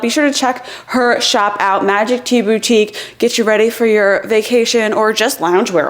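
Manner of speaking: ordinary talk, nothing marked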